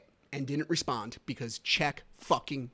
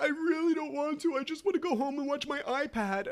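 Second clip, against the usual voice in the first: put-on high-pitched little boy pleading; whining child; 12 semitones higher than usual. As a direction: imitate a kid who wants out. Voicing his reluctant young son.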